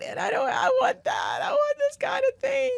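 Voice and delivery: overdone teary longing; mock wailing. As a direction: wail it like a movie made you sob. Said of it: "I'm just crying about it".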